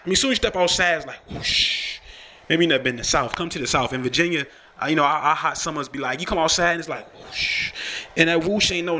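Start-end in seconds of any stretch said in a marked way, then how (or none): 1.3-2.0 long breathy whoosh with no voice; whoosh of hot air. Retold or imitated. Telling listeners how stepping outside feels, breathy whoosh. 7.2-7.7 airy unvoiced whoosh; whoosh of hot air. Describing Virginia summers to listeners, breathy whoosh.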